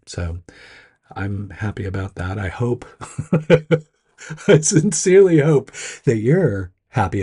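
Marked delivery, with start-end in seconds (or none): 2.9-3.8 short laugh breaking into the talk; real chuckle. Real, not acted. Host hoping listeners are happy too, chuckling. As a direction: let out a brief, higher chuckle.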